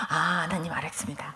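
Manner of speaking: low, very soft obedient reply; hushed submission. Retold or imitated. Woman retelling her obedient answer to God, hushed. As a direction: drop down into a humble, near-private acknowledgement.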